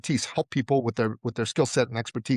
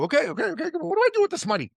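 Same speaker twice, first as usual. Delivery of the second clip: much higher, bewildered borrower voice; classic Iranian; 13 semitones higher, faster than usual. Retold or imitated. Son imitating a typical Iranian borrower to Heather, much higher. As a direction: go up high as the flustered borrower. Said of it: "Iranians are like this".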